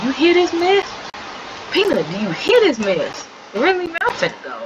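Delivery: exasperated, pitch leaping up; fed-up indignation. Acted. Lexi complains to Peanut about the mess, fed up. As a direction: demand a witness, voice jumping high.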